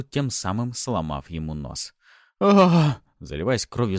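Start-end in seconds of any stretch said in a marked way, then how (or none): 2.4-3.0 loud, high agonized scream; drawn-out pained yell. A narrator's reading. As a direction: cry out as your nose breaks. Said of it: "закричал бандит".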